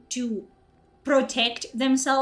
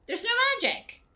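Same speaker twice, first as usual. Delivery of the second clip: much higher pitch on There's no magic; emphatic repeat; 11 semitones higher than usual. Real, not acted. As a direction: hammer the point home. Repeat in rant.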